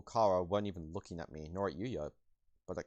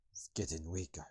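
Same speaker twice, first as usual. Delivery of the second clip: whispered; 3 semitones lower, 6 dB quieter, slower than usual. Acted. Dying friend, grief.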